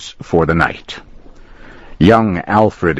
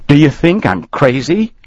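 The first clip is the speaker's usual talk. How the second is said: indignant protest; 9 semitones higher than usual. Acted. Accused boy protests angrily.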